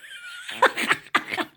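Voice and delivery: high cackle of amusement; tickled cackle; real laugh. Real, not acted. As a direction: laugh at your own story. Laughs at her own story.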